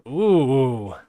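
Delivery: long, loud ooh sliding downward; impressed ooh. Real, not acted. Co-host reacts to lust for revenge, impressed ooh. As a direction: let out a big drawn-out "ooh".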